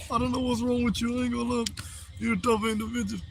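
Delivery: soft, high put-on voice; teasing in a quiet, high voice; mock high voice. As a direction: keep it small and high-pitched.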